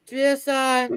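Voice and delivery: high, eager mock private voice; squeaky over-eager recruit impression; imitating Private Alpha. Drill sergeant mocks an eager private asking questions, high and eager. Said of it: "He would always ask a question like this".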